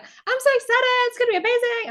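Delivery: very high, loud, giddy squeal of words; hyped-up excitement. Retold or imitated. Nervous traveller making herself believe it, hyped-up excitement. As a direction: talk yourself into being thrilled. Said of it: "I had to say it also to make me believe it".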